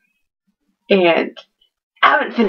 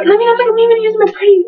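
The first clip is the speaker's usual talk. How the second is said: fast, high, eager gush; excited gush; 11 semitones higher, 4 dB louder, faster than usual. Real, not acted. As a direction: rush it out, thrilled. Gushing about her bookmark.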